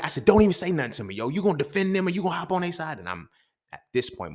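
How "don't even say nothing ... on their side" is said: high, indignant yelling at his mother; angry outburst. Retold or imitated. To his mama who pulled up, high indignant yell. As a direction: accuse her of taking sides.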